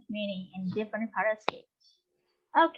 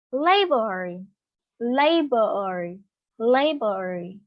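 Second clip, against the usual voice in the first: "labor" repeated as LA-bor, first syllable strong; teacher drilling "labor", stressing the first syllable; 3 dB louder, much slower than usual. Shown as a demonstration. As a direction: model "labor" with the beat on "la", pausing between repeats. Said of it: "nó stress on the first syllable".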